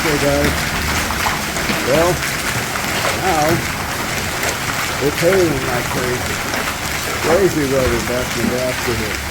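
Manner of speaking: talking loudly over pounding hail; in a hailstorm. Real, not acted. Loud hailstorm outside.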